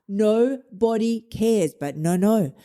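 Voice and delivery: normal talking voice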